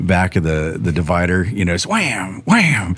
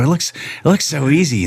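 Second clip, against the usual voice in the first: higher, wide-eyed naive greenhorn; naive eager me; 8 semitones higher than usual. Retold or imitated. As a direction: retell your younger self sounding eager. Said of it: "I go".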